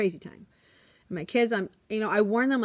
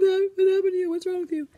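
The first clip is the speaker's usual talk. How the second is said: very high, mock panicked child voices; alarmed kids; 11 semitones higher, 4 dB louder, faster than usual. Kids seeing teacher's bloody nose, alarmed child voices. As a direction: squeal it like shocked first graders. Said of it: "The kids are gonna be like".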